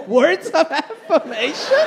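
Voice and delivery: high, slow disbelieving echo; rising, drawn-out disbelief; incredulous. Retold or imitated. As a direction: repeat it slowly as if absurd.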